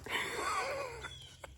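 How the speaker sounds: very high, soft giddy giggle; giddy laugh. Real, not acted. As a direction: laugh in disbelief. Giddy after scoring buck.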